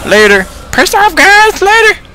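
very high, loud squeaky farewell; high-pitched plush toy signing off; squeaky Mister Snuggles. Acted. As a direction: call goodbye in a tiny toy shriek.

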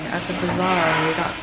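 muffled behind surgical mask; speaking with covered mouth; through a face mask. Real, not acted. Seen 0: Blue surgical mask covers mouth and nose while she speaks.

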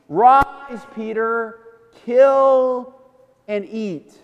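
slow, measured command with long pauses; God. Acted. Voice of God to Peter in his dream, slow solemn command. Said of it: "he hears this voice of God".

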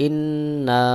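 ইন্না with nasal held n and lengthened ending; ghunnah and madd. Shown as a demonstration. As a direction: hum the nn of ইন্না, then stretch the aa one beat. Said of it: "গুন্নাহ রয়েছে এবং এক আলিফ টানও রয়েছে".

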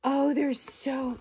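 usual speaking voice

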